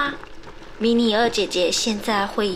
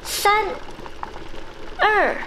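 excited countdown; 6 semitones higher than usual. Acted.

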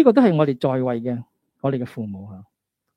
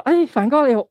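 friendly greeting; 8 semitones higher, 6 dB louder than usual. Retold or imitated. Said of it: "好少就係你話".

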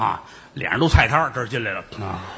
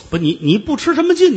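high, louder, 劲 falling hard; indignant retort; 12 semitones higher, 4 dB louder than usual. Acted. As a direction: throw 不吃什么劲 back at him, stuttering in outrage.

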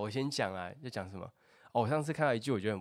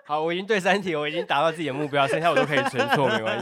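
louder, faster, laughing; excited gloating; 6 semitones higher, 11 dB louder, faster than usual. Real, not acted. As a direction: brag happily, quickly. Gloating after third answer.

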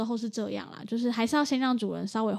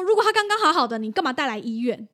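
higher and louder, sharp retort with 如果 thrown out loud; imitating the angry vet; 4 semitones higher, 7 dB louder than usual. Vet snapping back at the owner, retold as a loud exasperated retort. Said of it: "你就很生气".

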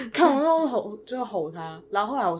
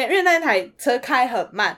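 ordinary delivery

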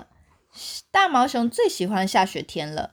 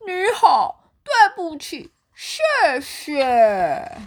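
high, lightly voiced bear saying it gladly; heartfelt greetings; 7 semitones higher, 3 dB louder, slower than usual. A narrator's reading. Bear sincerely greeting friends with gifts. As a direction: say each word with care. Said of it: "用心的对每一个人说".

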